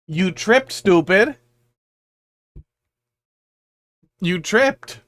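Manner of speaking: high, loud mocking of a clumsy cat; ribbing the cat for tripping; teasing the cat. Real, not acted. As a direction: call out the stumble, loud and gleeful.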